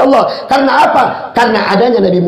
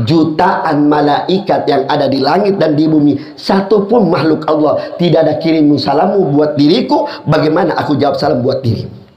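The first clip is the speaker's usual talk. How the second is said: solemn Prophet's reply; 7 semitones lower than usual. Acted. Prophet answers the servant in dream.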